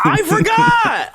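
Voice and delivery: high stretched-out yell; shouted. Acted. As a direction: wail it out slowly. Repeating "I forgot" to build.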